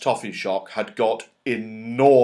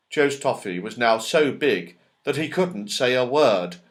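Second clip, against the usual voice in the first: usual speaking voice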